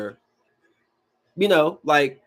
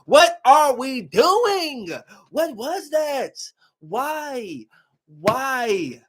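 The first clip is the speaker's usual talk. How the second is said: exasperated disbelief; 7 semitones higher, much slower than usual. Real, not acted. Exasperated rant at the movie.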